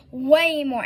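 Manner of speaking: insisting on the amount. Real, not acted. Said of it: "And when I say way more".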